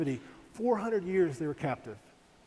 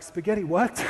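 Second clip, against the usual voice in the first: baffled; 3 semitones higher, 7 dB louder than usual. Retold or imitated. Shocked at spaghetti squash.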